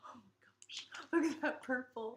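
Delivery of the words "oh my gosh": hushed and breathless over the yarn; awed whisper. Real, not acted. To her co-host, opening the yarn box, awed whisper. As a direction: whisper it like you can't believe your eyes.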